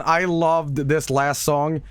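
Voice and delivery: ordinary talk, nothing marked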